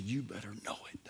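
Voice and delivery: soft whisper. Acted. Father to son, tender whisper. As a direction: breathe it tenderly.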